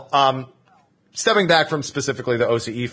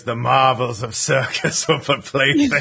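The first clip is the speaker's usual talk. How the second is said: laughing whisper; slower than usual. Pretend. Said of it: "should have whispered in their ears".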